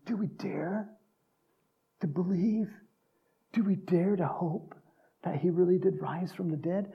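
half-whispered, tentative wonder; hushed hope. Acted. Preacher as disciples before Jesus appeared, hushed and hopeful. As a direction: barely dare to say it aloud.

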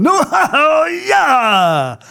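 high, loud hammed-up wail of protest; mock angry outburst. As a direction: throw a loud, drawn-out mock tantrum. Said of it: "he got all mad".

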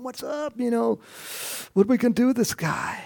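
high, pleading, a bit fast; anxious Samuel begging God; high anxious plea. Retold or imitated.

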